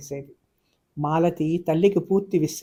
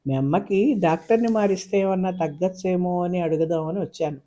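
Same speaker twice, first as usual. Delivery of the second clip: plain reading voice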